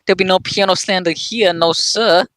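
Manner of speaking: high-pitched playful protest; mock indignation. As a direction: object in pretend outrage. Mock protest at piano slander.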